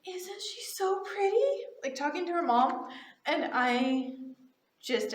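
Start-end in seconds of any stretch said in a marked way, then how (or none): 0.1-1.6 put-on high child voice, rising question; pitched-up little-kid wonder; little girl. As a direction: imitate a small child admiring someone to mom. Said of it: "the girl was like".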